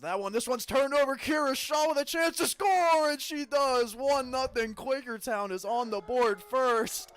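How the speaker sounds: loud, high, drawn-out goal call; excited goal call. Real, not acted. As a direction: shout the goal to the crowd. Goal scored, live call.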